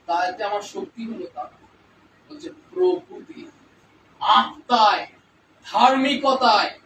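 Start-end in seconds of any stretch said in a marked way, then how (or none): none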